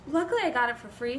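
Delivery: ordinary talk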